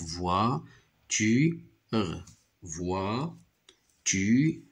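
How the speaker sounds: voiture split into voi... tu... re; three separate beats, voi - tu - re; syllable by syllable. Shown as a demonstration. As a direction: beat out each syllable of voiture. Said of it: "contient trois syllabes".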